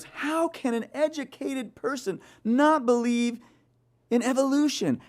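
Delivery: high, mocking indignant colleague voice; imitating the office mate's indignant disbelief. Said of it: "And she goes".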